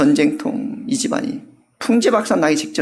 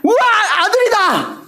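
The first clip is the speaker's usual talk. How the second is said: high, bursting with joy at a son; joyful cry. Shown as a demonstration. Mother overjoyed at her newborn son, high excited cry. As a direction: shout it out like a delighted mother. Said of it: "이렇게 아니고요".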